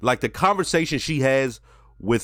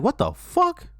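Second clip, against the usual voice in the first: much higher, startled outburst; mock startled blurt, pitched way up; shocked what the fuck; slower than usual. Tony startled as his therapist cries, high shocked outburst. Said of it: "Tony is like".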